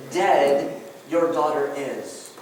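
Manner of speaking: "Dead" hit hard, then a slow finish; slow, weighty stress on "Dead"; very emphatic. Shown as a demonstration. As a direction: punch "Dead" up front and stretch it out. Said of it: "the Greek is very emphatic".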